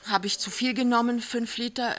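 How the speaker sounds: put-on a young wife realising her mistake; dismayed young wife. A narrator's reading.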